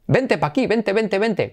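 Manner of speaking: high, repeated beckoning call; calling someone over. Acted.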